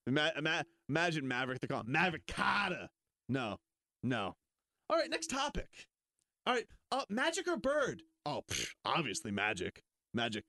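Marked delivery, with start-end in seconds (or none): 4.9-8.0 much higher, bright; hammed-up announcer question; mock show host. Host posing the next debate, high and bright.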